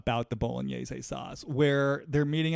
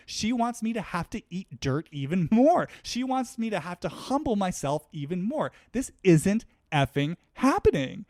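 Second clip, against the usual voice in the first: outraged Lisa Rinna; 3 semitones higher than usual. Retold or imitated. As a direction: build Rinna's fury to a challenge. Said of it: "Lisa Rinna interprets this even more as".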